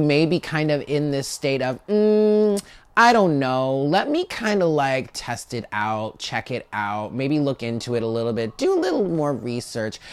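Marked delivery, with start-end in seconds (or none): 1.9-10.0 hesitant stalling. Acted. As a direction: stretch the words out like someone putting it off. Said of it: "Do you hear the tone in my voice".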